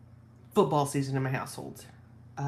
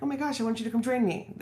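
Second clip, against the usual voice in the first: high, quick imitated pleading; acted-out eagerness, quick and high; mock eager invitation; 7 semitones higher, faster than usual. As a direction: play up the keen invite, pitched high. Acts out eager invitation from joke.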